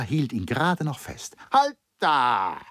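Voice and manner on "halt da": sharp command. A narrator's reading. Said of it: "sagte er".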